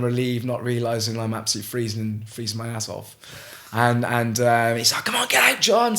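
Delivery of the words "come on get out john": high, louder retold shout; imitated urgent call. Helper calling the freezing swimmer out of the water, urgent shout. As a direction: urge him out, pitched high and loud.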